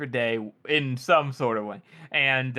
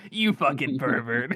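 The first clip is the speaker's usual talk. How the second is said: grinning taunt. Retold or imitated. She grins more at his refusal, grinning taunt. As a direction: tease him with a put-on, grinning voice. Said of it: "she just grins even more".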